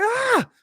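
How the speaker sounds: put-on very high-pitched girly shriek; girlish scream. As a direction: imitate yourself screaming like a little girl. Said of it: "screaming on stream like a little girl".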